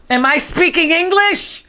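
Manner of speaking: lightly voiced haughty politician, slow; a hint of a sharp, slow put-down; Nancy Pelosi. Host quotes Pelosi snapping at a reporter, lightly haughty and slow.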